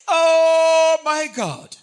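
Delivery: slow, loud, high anguished oh my God; horrified cry. Acted. Preacher voicing Peter seeing Jesus beaten, horrified cry. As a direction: cry out in horror at the beating.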